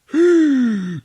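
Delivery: sharp high gasp for air, loud. Retold or imitated. Retelling a movie, she surfaces from the pool with a gasp. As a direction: suck in air like surfacing from water.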